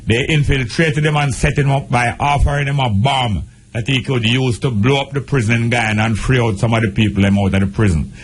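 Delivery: normal talking voice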